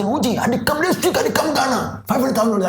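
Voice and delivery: high-pitched excited friend on the phone; voicing the friend. Friend calling to say he's coming, high and excited.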